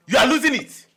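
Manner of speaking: very loud, high accusation; exasperated shout. Acted. Telling obsessed friend he is losing it, exasperated shout. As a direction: yell it right at him.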